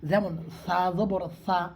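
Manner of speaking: sa said soft and slow, সা with a soft lisped s. Shown as a demonstration. Teacher shows a soft সা to students. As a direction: demonstrate সা softly for the Quran class. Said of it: "নরম করে".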